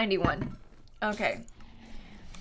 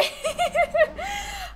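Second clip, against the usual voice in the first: high-pitched burst of laughter; 12 semitones higher, 5 dB quieter than usual. Real, not acted. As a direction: let out a high, real laugh. Laughs after oh boy.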